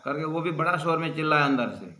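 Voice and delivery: ordinary talk, nothing marked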